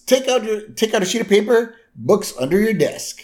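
high-pitched schoolteacher voice; imitated classroom command; acted teacher's instruction. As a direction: order the class in a put-on teacher voice. Edna ordering a surprise quiz.

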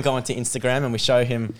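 usual speaking voice

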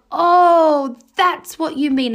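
acted penny-drop moment, loud then easing; sudden realization. Students telling their teacher they finally get it, sudden realization.

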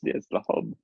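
hushed, breathy repeat of the catchphrase; whisper. Acted. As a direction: whisper it like a secret punchline.

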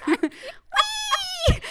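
high gleeful whee; playful squeal. Pretend. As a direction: squeal like on a ride. Pretend flipping, squeal.

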